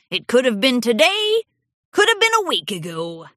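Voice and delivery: put-on old stallion voice. A narrator's reading. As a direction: muse like a forgetful old man.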